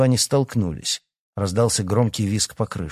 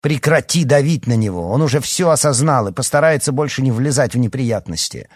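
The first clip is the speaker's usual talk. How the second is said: raised, higher, indignant voice; urgent protest; 4 semitones higher, 6 dB louder than usual. A narrator's reading. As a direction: push back loudly in his defence. Mother defends son to father.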